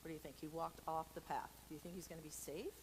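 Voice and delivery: usual speaking voice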